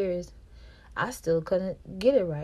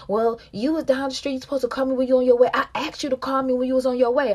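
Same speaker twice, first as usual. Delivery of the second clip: raised, higher voice scolding her; mock angry boyfriend; 6 semitones higher, 8 dB louder, faster than usual. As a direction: imitate him snapping about the phone call. His angry complaint retold.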